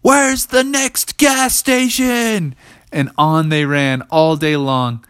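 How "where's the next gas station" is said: high, drawn-out holler; slow, high-pitched dinosaur scream; screaming T-Rex. A narrator's reading. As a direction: yell it back to your rider. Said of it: "the T-Rex screamed back to Froggy".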